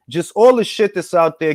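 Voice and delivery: ordinary talk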